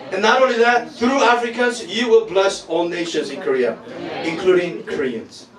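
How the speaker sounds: loud, high, fervent preaching; fiery preaching. Acted. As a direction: preach it with full force. Preaching at full force.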